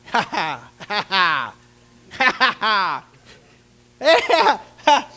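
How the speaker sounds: hammed-up mocking laugh; king mocking David, hammed-up laugh; mocking laughter. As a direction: laugh at the boy. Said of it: "Did you hear what he said".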